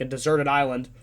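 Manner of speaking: ordinary delivery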